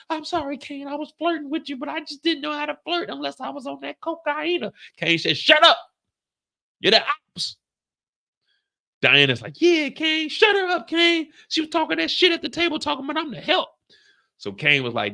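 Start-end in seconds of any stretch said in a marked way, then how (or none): 0.1-4.7 very high, soft mock female voice; high, whiny female apology; pleading woman. 5.4-7.6 angry snap. Retold or imitated. Kane snapping at Anya. 9.6-13.7 a spiteful woman urging him on; egging-on woman. Retold or imitated. Diana voiced egging on.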